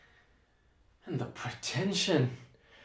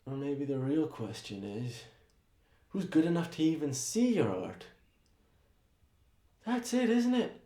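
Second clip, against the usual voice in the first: cold hush; 12 dB quieter than usual. Acted. Tirade drops to a cutting hush.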